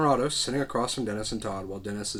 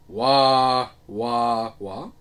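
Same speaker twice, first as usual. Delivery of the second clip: mocking wah; 9 dB louder, much slower than usual. Bobby mocks killer outfit.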